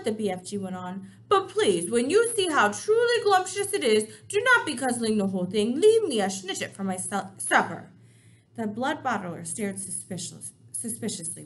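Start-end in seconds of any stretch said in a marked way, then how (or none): 1.3-7.9 enthusiastic BFG. Acted. BFG coaxes.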